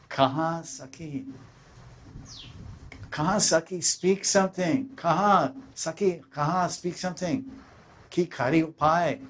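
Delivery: high, pleading cry to her friend; Radharani's lament. Acted. Radharani begging her friend, high pleading lament.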